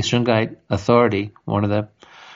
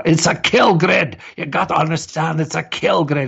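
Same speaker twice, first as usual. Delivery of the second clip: high-pitched, intense mimicry of an accented alarmist; mock-urgent impression, voice jumping high; Mark Steele impression; 7 semitones higher than usual. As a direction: imitate an insistent doom-warner, pitched way up. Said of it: "with his accent and his personality as well".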